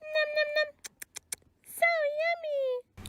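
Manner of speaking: very high, soft little chick; tiny chick voice. Acted. Voicing the hungry chicks, very high and soft. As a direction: squeak it like a baby chick.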